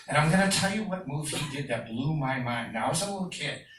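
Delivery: ordinary delivery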